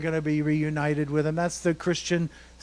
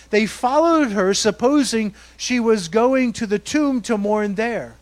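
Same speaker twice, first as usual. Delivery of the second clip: louder, pushed-forward Bible reading; preacher reading Scripture aloud with fire; fervent scripture reading; 5 semitones higher, 8 dB louder than usual. Real, not acted. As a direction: read it like the story still burns. Reads the passage loud and driven.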